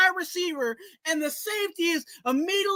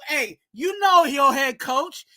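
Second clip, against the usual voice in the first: ordinary delivery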